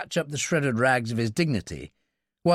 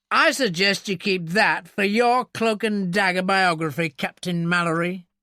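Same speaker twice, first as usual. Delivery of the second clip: sneering, higher-pitched mock suggestion; unpleasant smile; 6 semitones higher, 4 dB louder than usual. A narrator's reading. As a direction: needle him through a nasty smile. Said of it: "He smiled unpleasantly".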